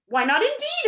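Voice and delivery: high, loud, emphatic exclamation; exclaimed. A narrator's reading. Bug switching sides to back Milo, high exclamation. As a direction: exclaim it with a swooping pitch. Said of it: "exclaimed the bug".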